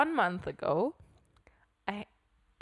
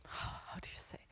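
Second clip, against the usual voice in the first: whispered aside; 15 dB quieter than usual. Real, not acted. Searching for an English word.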